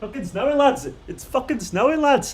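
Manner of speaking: high, gleeful snow announcement. Real, not acted. Telling the lads it's snowing, high and gleeful. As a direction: crow it to the lads.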